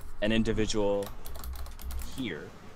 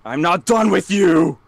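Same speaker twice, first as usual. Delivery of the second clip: put-on loud, higher furious yell at a kid; angry man shouting; 7 semitones higher, 8 dB louder than usual. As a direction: bellow it after a fleeing kid. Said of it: "you hear, all of a sudden".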